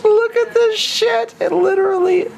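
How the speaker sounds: hammed-up loud squeal; shrieked reveal. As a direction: squeal it at the top of your voice. Shrieking at the dish.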